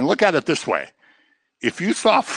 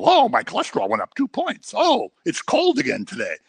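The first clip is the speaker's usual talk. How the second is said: high, whiny mock grumbling; much higher, petty complaining voice; mock whining complaint; 11 semitones higher than usual. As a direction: whine it like a spoiled grumbler. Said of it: "but yet we complain".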